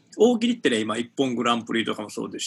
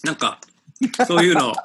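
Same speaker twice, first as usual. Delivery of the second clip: 小魚を噛みながら話す; 口にものを入れたまま; mouth full; 5 semitones higher, 5 dB louder than usual. Real, not acted. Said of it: "食うのやめれ。". (口いっぱいで話す)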